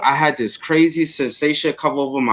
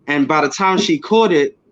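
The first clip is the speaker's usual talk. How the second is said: ordinary talk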